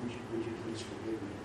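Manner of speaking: quiet, stammering plea for forgiveness; hushed, sheepish stammer; soft mock apology. Preacher voicing apology to wife.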